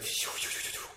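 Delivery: soft unvoiced rapid whooshes; imitated whooshing noise. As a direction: puff out a run of whooshes. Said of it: "Suddenly it was like".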